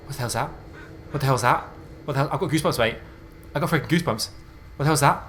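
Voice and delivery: increasingly high, loud alarmed questions; freaking out. Real, not acted. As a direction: build from uneasy to rattled disbelief. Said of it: "freaking out right now".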